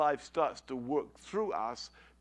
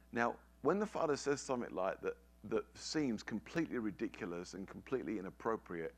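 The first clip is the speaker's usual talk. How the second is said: usual speaking voice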